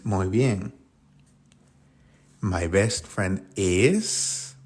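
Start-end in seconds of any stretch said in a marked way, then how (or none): 2.4-4.6 very slow, drawn out, word by word; careful classroom model; slow teacher demo. Shown as a demonstration. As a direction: build the sentence slowly for students. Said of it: "¿Cómo digo eso?".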